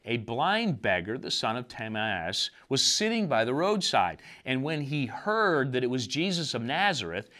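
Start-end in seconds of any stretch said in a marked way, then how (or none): none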